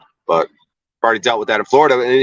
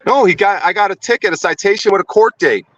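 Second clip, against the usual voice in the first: angry; 7 semitones higher, faster than usual. Real, not acted. Angry about the ticket.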